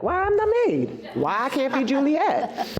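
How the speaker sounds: put-on high, whining little girl asking why; indignant child. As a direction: plead like a hurt kid wanting to be Juliet.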